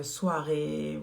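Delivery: soirée said slow and long; slow drawn-out soirée. Real, not acted. Telling viewers the lipstick is for evenings, drawn out slowly. Repeats the word for the evening look.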